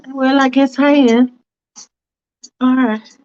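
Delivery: a fussy woman hedging politely; Faith's fussy voice. Acted.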